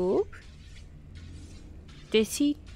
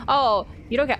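ordinary talk, nothing marked

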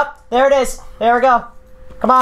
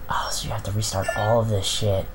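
whispering; 5 semitones lower, 5 dB quieter than usual. Real, not acted. Whispering while playing horror game.

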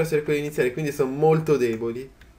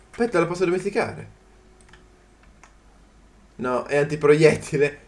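ordinary talk

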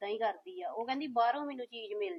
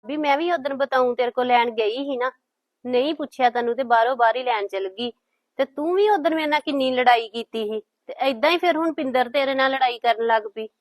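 much louder, arguing back hard; raised voice in a family row; loud scolding; 13 dB louder than usual. Acted. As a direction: shout the old quarrel at her. Heated family argument.

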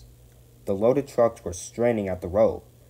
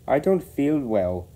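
slow, raised groan of feigned illness; 6 semitones higher, 5 dB louder than usual. A narrator's reading. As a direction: groan it slowly, playing poorly. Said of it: "he groaned next morning".

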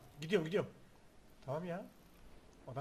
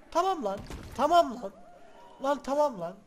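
annoyed shout; 5 semitones higher, 10 dB louder than usual. Real, not acted. Frustrated gamer repeating tamam lan.

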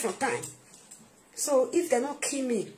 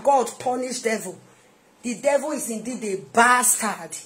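usual speaking voice

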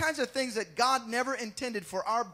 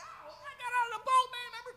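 much higher, cocky protest; pitched-up mock indignation; boastful Peter; 13 semitones higher, faster than usual. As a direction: brag like a wounded fisherman. Peter defending himself to Jesus.

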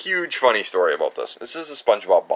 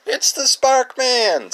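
announcer; 5 dB louder, much slower than usual. Pretend. Introducing a figurine like an announcer.

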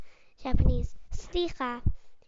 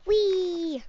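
gleeful whee; 4 semitones higher than usual. Acted. Playing on slide.

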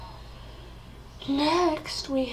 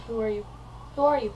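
ordinary delivery

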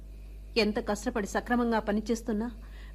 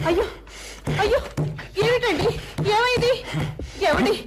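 much higher, louder frightened pleas; panicked pleading; 11 semitones higher, 4 dB louder than usual. Acted. Wife begging raging husband to stop hitting the table: panicked high pleading. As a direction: beg him to stop, alarmed.

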